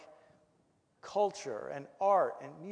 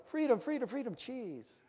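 high chant dropping softer at the end; dog's thoughts; 5 semitones higher, 5 dB quieter than usual. Retold or imitated. Runaway dog torn between liberty and food, playful imitation. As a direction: imitate a dog getting distracted mid-chant. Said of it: "you just see him go".

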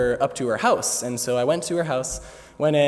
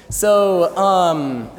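ordinary talk, nothing marked

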